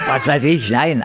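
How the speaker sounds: ordinary talk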